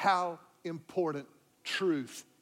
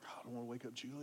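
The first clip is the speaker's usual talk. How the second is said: much softer, lower under-the-breath words; hushed aside; 5 semitones lower, 14 dB quieter, much faster than usual. Retold or imitated. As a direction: keep it down so the wife sleeps. Not waking sleeping wife.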